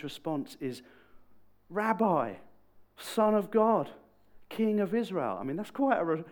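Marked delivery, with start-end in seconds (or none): 1.7-5.4 loud, high proclamation; exclaiming. Retold or imitated. Nate responding to his supernatural encounter, loudly exclaiming. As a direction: cry out each title in wonder. Nate's awed response after ditching cynicism.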